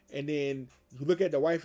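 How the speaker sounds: ordinary talk, nothing marked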